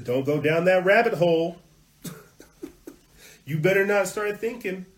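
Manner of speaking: high, loud mocking admonishment; mock warning. Mockingly acting out newspaper's warning.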